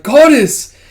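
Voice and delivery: high, loud exclamation of horror; shocked cry. Acted. Kippa sees the scientist burn, shocked cry. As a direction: cry out sharply at a high pitch.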